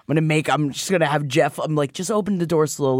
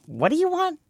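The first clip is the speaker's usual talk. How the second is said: high incredulous; 14 semitones higher than usual. Retold or imitated. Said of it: "I'd be like".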